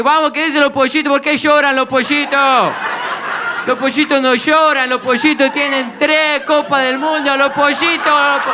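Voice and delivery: high, excited Argentinian football-fan chant; rally the crowd in a hammed-up Argentinian accent. Argentinian boasting of World Cups, excited mock accent. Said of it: "en argentino".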